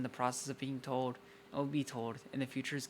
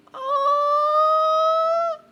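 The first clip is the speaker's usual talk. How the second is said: very loud, very high long squeal; delighted squeal; 17 dB louder than usual. Real, not acted. Reader thrilled by the lovers touching, delighted squeal.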